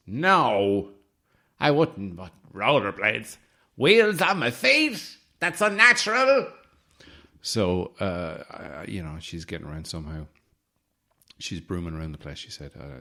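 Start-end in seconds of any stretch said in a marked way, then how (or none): 0.1-6.5 imitating a friend. Quoting Cara in a put-on voice.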